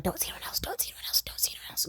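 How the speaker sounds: whispered, rapid, insistent repetition; mimicked urgent whisper. Possessive boyfriend begging her to stay exclusive, mock urgent whisper. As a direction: whisper it obsessively, mocking him.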